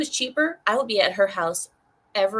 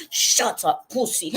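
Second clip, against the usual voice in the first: imitated Russian masseuse; slower than usual. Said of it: "she's like".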